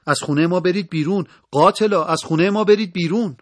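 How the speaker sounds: high, loud defiant yell; acted angry shout. A narrator's reading. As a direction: scream at the intruders to leave. Daughters confront armed men invading home.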